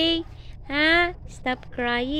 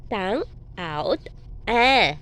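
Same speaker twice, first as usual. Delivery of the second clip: usual speaking voice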